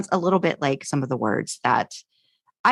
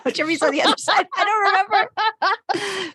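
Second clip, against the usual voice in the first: laughing. Real, not acted. Laughing at own geography.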